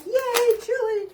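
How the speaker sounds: high, loud happy cheer; excited cheer. Retold or imitated. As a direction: cheer her on like she just won. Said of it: "I was like".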